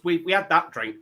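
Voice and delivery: usual speaking voice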